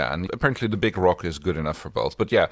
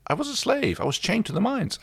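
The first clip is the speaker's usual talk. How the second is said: imitating Jothi. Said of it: "Jothi confesses to Chiana".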